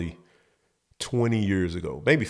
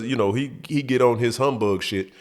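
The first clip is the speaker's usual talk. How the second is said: normal talking voice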